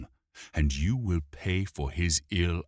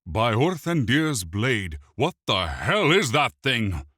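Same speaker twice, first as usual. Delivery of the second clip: howled; 6 semitones higher, 5 dB louder than usual. A narrator's reading. The elf prince howls at the attacking imp, loud. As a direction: howl in outrage at the beast. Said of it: "Mirthandir howled".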